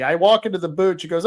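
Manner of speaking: ordinary talk, nothing marked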